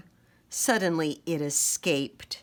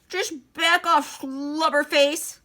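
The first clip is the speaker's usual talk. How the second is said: high, slow, puffed-up snarl; angry snarl; 8 semitones higher, 3 dB louder than usual. Acted. Angry chameleon snarls an insult at his rival. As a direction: glare him down with the insult. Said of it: "Willie and Wally were getting very angry".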